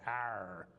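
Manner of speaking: light pirate Arr demo. Shown as a demonstration. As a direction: show how a pirate growls. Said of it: "Pirates say".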